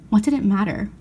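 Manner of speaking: ordinary delivery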